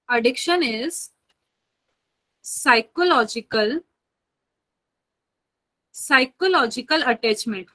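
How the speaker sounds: slow, spaced-out dictation with long pauses. Acted. Teacher dictating a definition to her students, slow and spaced. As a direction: spell the definition out word by word for note-taking.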